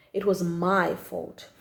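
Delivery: plain reading voice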